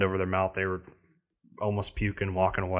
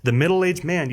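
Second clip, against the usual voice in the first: ordinary talk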